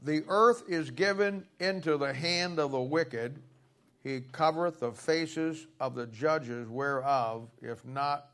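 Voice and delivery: slow, deliberate Bible reading; scripture reading. Acted. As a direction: read the verse slowly and firmly. Reads scripture aloud.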